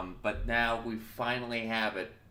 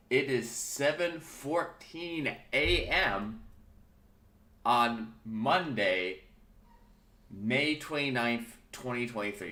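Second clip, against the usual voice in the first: slow, drawn-out, raised pitch; slow grand announcement; 3 semitones higher, 4 dB louder, slower than usual. Real, not acted. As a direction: announce the date like a radio host. Grandly announcing the time and date.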